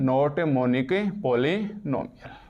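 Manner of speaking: drawn-out, word-by-word repeat; dictation pace. Real, not acted. Teacher repeating the answer for students to write, slow dictation. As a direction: say it slowly for note-takers.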